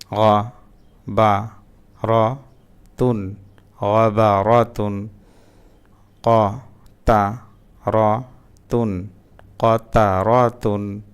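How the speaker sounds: syllables split apart with pauses, then joined; broken syllable reading. Shown as a demonstration. Teacher showing learners broken-up syllable reading, slow and separated. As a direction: read it piece by piece, then blend the word. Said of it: "এভাবে ভাঙা ভাঙা উচ্চারণ পড়তে গিয়ে".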